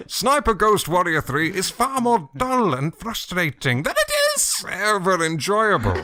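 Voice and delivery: squeaky puberty voice. Acted. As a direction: push the whole read up into a teenage squeak. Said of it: "Alan is going through puberty and his voice is cracking".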